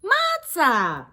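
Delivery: loud, high cheer of the answer; excited answer. Shown as a demonstration. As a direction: call out the answer with glee. Teacher cues children's answer.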